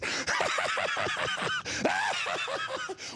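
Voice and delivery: breathy high laugh fading down; long laugh. Real, not acted. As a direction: let out a long cackling laugh. Laughing at the movie quote.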